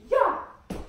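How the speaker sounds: sharp high yell on the beat; shout. Shown as a demonstration. Dance teacher showing the class the shout. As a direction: belt it out like a dance cheer. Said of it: "Puis là, on peut même crier".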